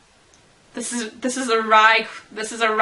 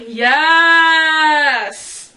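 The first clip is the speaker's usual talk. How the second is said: loud, high, drawn-out yes; Andy shouting yes in triumph; excited yes; 4 semitones higher, 7 dB louder than usual. Acted. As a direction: cheer it out like a win. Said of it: "Andy would be like".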